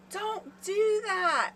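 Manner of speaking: high, slow whiny mock yell without much volume; mock sleep scream. Wife imitating her sleeping husband's scream, high and slow. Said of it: "And he screamed".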